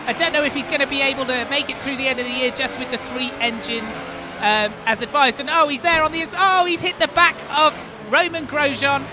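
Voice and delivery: high, loud race call; motorsport commentator. Acted. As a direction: call the action live.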